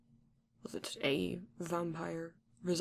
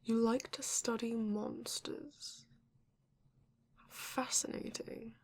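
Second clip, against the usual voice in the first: intrigued whisper; 3 semitones higher, slower than usual. Acted. Vampire intrigued by monster scholar.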